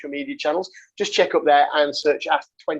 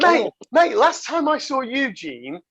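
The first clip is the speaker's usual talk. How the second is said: excited; 7 semitones higher, slower than usual. Real, not acted. Excited start of the anecdote.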